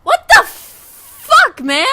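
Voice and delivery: high, loud, slow what the fuck; stretched outraged shout; outraged yell. Real, not acted. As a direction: drag it out in disbelief. Streamer yelling at game character.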